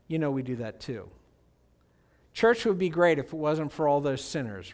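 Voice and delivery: ordinary delivery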